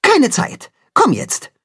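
brisk, hurried command; curt. Acted. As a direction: cut him off, no time to explain. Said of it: "antwortet knapp".

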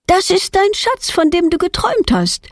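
put-on high, excited little-girl voice; aufgeregter Ausruf. A narrator's reading. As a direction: call it out in delighted discovery, pitched well up. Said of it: "ruft Petronella und springt auf". (aufgeregt und mit hoher Stimme gerufen)

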